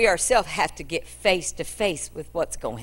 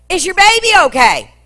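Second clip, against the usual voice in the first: much higher, louder urgent call; shouted question; 12 semitones higher, 12 dB louder than usual. Acted. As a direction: shout after her desperately. Servant chasing the woman.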